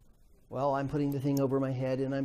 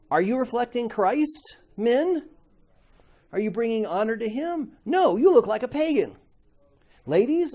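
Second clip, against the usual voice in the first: higher, louder preacher's challenge; Paul confronting the church; 7 semitones higher, 6 dB louder than usual. Acted. Paul challenging the Corinthians, higher and louder. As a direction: voice Paul pressing hard with each question. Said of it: "that Paul's saying".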